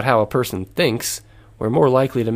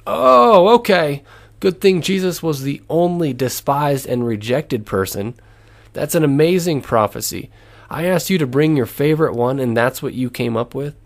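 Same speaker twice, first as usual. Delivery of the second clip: higher, louder mock-impressed "oh", then mocking disbelief; sarcastic; 4 semitones higher, 4 dB louder than usual. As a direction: act out a sarcastic host cutting someone off. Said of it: "saying sarcastically".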